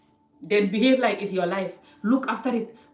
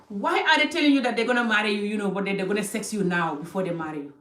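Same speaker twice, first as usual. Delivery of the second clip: normal talking voice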